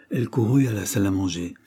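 ordinary delivery, nothing marked

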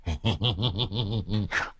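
rattling string of short chuckles; acted old man's chuckle; old prankster's chuckle. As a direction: chuckle to yourself, mischievously. Prankster Đồng laughing in story.

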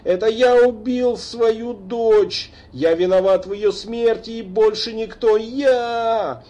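high, loud, slow wailing; anguished confession. A narrator's reading. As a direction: howl out the guilt. Father beats chest confessing. (надрывное признание с воплем)

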